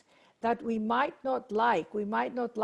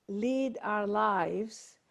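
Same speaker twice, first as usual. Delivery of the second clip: ordinary talk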